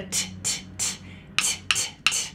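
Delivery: isolated voiceless "t", repeated in short separate taps; tapping T sound. Shown as a demonstration. Therapist working on the T sound, "t" tapped like a hammer. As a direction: tap out "t, t, t" with no vowel after it. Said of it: "working on the T sound".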